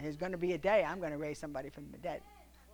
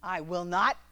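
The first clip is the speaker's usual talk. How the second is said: mock stubborn refusal, a bit louder; 6 dB louder, slower than usual. Husband refusing his wife, mock stubborn. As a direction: dig in like a sulking man. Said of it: "He said".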